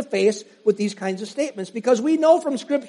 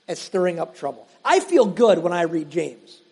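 ordinary delivery

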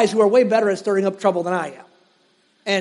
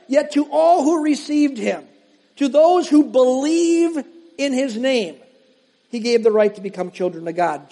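raised, measured reading of Paul; preacher reading scripture aloud; 3 semitones higher than usual. Acted. Reads John aloud.

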